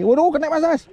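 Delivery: high excited call that it's hooked; excited cry. Real, not acted. Angler calling to Mas Dani on a bite, excited cry. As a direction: yelp with joy at the bite.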